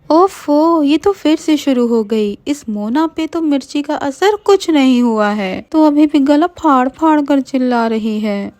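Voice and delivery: put-on high, irritated girlish voice; grumble, woken again; annoyed. Meenal woken by the singing, high and irritated. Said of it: "गुस्से में उठती है और कहती है".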